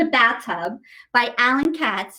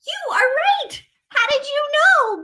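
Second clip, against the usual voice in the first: much higher, delighted and laughing; excited praise; 11 semitones higher than usual. Real, not acted. Teacher praising the child's guess, excited. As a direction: cheer for the right guess.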